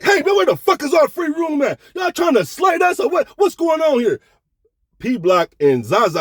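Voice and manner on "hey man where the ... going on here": high, loud indignant shout; yelling demand. Retold or imitated. Narrator hollering at casino staff about the free room, high shout. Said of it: "I automatically".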